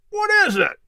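a grumpy, high snap of a woken man; mock irritation at being kicked awake; hammed-up grouch, pitched high; scowling. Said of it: "he scowled".

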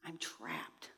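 faint, hopeless whisper; despairing whisper. Retold or imitated. Trapped on the mountain, telling herself so in a faint whisper. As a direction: barely breathe it out. Self-pitying inner voice.

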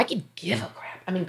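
voiceless, dismissive whisper; whispered aside. Real, not acted. Woman dismissing petty judgments to her followers, whispered aside. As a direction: drop it to a quick whisper.

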